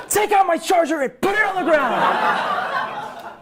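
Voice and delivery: laughing shout. Retold or imitated. Demanding the charger too.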